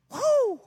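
high loud mock woo; excited whoop. Preacher imitating excited people, high whoop.

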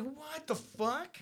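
slow, quiet stunned question; jaw-dropped disbelief. Retold or imitated. Listener hearing Oh Darling born, quiet stunned. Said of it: "and you're like".

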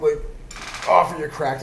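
ordinary delivery

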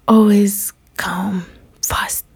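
slow secretive whisper. Acted. Host telling listeners to put themselves first, slow whisper. Advice on putting yourself first.

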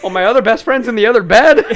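loud, high words through laughter; loud laughing exclaim; laughing shout. Real, not acted. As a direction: blurt it out cracking up. Laughing at own punchline.

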